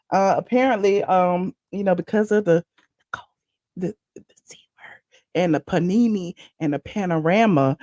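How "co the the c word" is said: whispered aside, hiding the C word; joking whisper. Real, not acted. Host whispers the forbidden word to viewers, whispered. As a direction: hush it like a secret joke.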